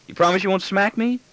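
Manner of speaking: put-on high-pitched child's question, rising at the end; anxious boy's plea. Son asking his father to promise, high pleading voice. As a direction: seek reassurance in a small boy's voice.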